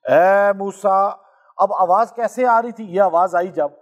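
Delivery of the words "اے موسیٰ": stretched, slow اے موسیٰ; slow solemn call. Acted. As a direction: call out the name slowly and solemnly. Divine call quoted. (اے موسیٰ آہستہ، سنجیدہ پکار)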